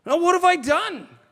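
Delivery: a wounded why me; indignant David. Retold or imitated. David protesting to his brother, indignant. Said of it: "said David".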